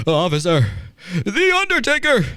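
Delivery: high, pleading imitation of a rattled old man; mock Vince pleading. Host imitating Vince telling the cop a wild excuse, high and whiny. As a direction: whine it up high like a panicked old boss. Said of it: "what he said to the cop was".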